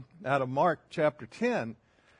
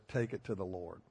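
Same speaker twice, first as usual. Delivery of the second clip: much lower and softer, trailing away; fading soft repeat; 8 semitones lower, 10 dB quieter than usual. Retold or imitated. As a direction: let the last repeat die off to a hush. Repeating the elders' prayer saying.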